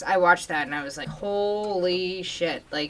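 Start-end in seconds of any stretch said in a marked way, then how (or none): 1.1-2.6 drawn-out, hushed amazement; recalled reaction, slow and softer; retold awe. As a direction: stretch it right out, softly stunned. Retelling own stunned reaction to film.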